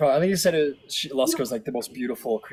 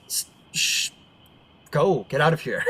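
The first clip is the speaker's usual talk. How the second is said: shooing; 5 semitones higher, 7 dB louder than usual. Acted. Ranger shoos the wolf away.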